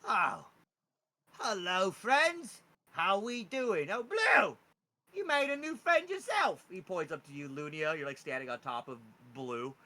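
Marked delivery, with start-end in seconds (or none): 0.1-6.6 louder, higher charmed goblin greeting; overjoyed charmed goblin, loud and high. Acted. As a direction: gush like a freshly charmed goblin. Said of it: "He's like".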